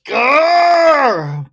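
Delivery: loud frustrated growl, pitched high; angry growl. Acted. Venting about rude customers with a loud growl. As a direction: let out a full Grr of rage.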